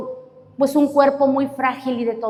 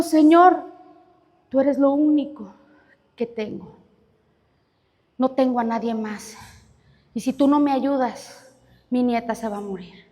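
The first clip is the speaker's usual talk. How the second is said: quiet prayer; 3 semitones lower, 6 dB quieter than usual. Acted. Old woman prays alone in church.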